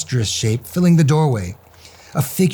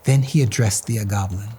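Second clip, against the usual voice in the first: ordinary delivery, nothing marked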